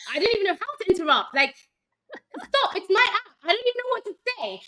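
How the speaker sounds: high, fast, flustered inner monologue; flustered panic. Retold or imitated. Herself stuck, unable to interrupt the pitch, flustered. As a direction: rattle it off in rising panic, half laughing.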